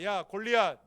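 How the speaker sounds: put-on brash, defiant young fighter; David taunting Goliath. David taunting Goliath before battle, brash. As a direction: taunt the giant, fearless. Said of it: "내가".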